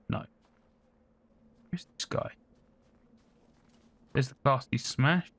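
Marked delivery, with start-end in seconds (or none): 0.1-2.3 hushed whisper, barely there. Real, not acted. Creeping through the map, whispering to viewers. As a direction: whisper so the enemy won't hear. Sneaking around in a game.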